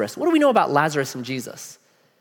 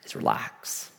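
soft whisper; 7 semitones lower, 11 dB quieter, slower than usual. Real, not acted. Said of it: "The first order of business".

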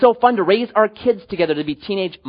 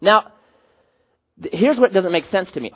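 ordinary talk

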